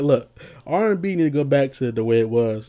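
usual speaking voice

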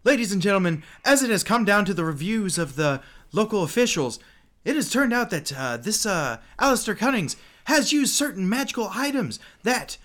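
high, loud ring announcer. Acted. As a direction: proclaim it to the whole arena. GM as tournament announcer.

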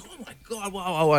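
retold awe, very soft; hushed awe. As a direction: drop right down into an awed little murmur. Retelling his awed reaction to winnings.

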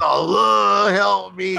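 loud, high mock cry for help; high-pitched plea. Imitating the toxic-waste victim begging for help, high-pitched wail. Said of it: "like, high pitch".

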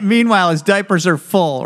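ordinary delivery

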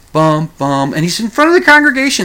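ordinary talk

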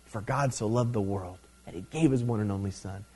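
low, very soft scripture quote; whispering in your ear. Acted. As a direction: drop to a hushed, intimate murmur. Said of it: "he's whispering in your ear".

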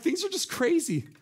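exasperated sigh. Retold or imitated. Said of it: "been like".

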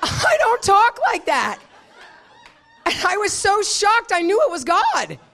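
high, loud exclamation through laughter; laughing protest. Real, not acted. Preacher insisting to the church she was shocked, laughing loudly.

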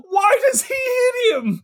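high, strained cry of disbelief; half-shouting in real frustration; cracking yell of outrage; exasperated yelling. Real, not acted. Host outraged at senseless punch.

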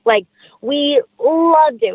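drawn-out gushing; slow, high, delighted; excited. Real, not acted. Gushing about favorite movie.

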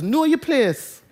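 Jamaican patois "Know your place", "place" said like "pleess", "your" a quick "yuh". Shown as a demonstration. Preacher showing the congregation his Jamaican mum's patois warning. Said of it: "And I'd say that in patois".

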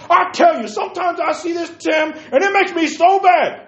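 sudden loud high burst; worked-up outburst. Real, not acted. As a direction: let it boil over. Ranting about preachers' opinions.

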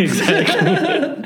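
high, slow laugh into the word; cracking up while agreeing. Real, not acted. Agreeing with a friend about Mardi Gras, laughing.

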